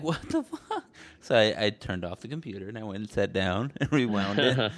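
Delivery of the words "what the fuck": what the fuck shot way up in pitch; a squeaky high burst of disbelief; shocked disbelief. Retold or imitated. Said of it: "And I was like".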